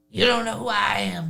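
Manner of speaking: a light imitation of the rapper's voice; lightly voiced rapper asking a question. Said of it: "now I'm gonna go back to a regular".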